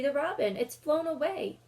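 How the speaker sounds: put-on a child calling after a lost bird; Lucy cries that the robin has flown; excited cry. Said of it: "cried Lucy".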